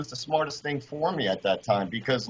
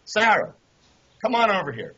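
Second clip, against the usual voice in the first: calling out; 8 semitones higher than usual. Retold or imitated. Coach summons Sarah to the bench, calling out. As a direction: call her over like a coach would. Coach calls the girl over.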